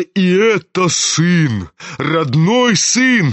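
slow, drawn-out indignant cry; frenzied exclamation. A narrator's reading. General reproaches his own son, slowly exclaiming. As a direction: stretch each word in wounded outrage. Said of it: "вскричал он в выступлении".

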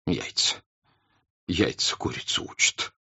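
whispered, choked with happy tears; through happy tears. Acted. The count embracing his wife through happy tears. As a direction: barely get it out, moved. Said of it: "сквозь счастливые слёзы".

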